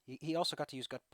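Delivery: ordinary talk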